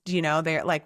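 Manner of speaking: ordinary delivery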